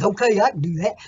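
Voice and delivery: a hint of a dog voice; dog character. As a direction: answer up as the dog, loudly. Acts out the dog replying.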